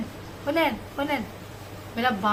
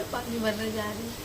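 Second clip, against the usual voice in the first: usual speaking voice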